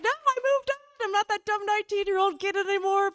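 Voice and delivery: high triumphant brush-off; proud rejection. Retold or imitated. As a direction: relish turning him down.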